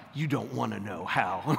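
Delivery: low, soft menacing hitman line; creepy hitman. Retold or imitated. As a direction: lean in like the man in the shadows. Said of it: "they come in real creepy".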